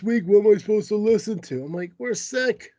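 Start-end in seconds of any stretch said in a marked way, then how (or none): none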